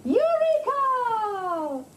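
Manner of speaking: usual speaking voice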